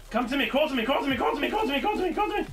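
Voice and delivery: high, repeated urgent chant; frantic calling. Pretend. Downed player calling a teammate over, frantic high chant.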